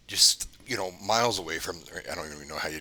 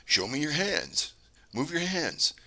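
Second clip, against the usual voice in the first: high, slow imitation of a child; a hint of eerie kid, pitched well up; weird kid's voice; 9 semitones higher, slower than usual. Voice from camera speaker orders wife, slow high kid imitation. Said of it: "really weird kid's voice".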